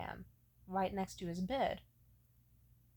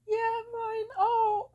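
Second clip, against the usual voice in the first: high, loud wincing cry; pained cry; 14 semitones higher, 9 dB louder, much slower than usual. Retold or imitated. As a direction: yelp through the pain. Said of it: "as I was saying".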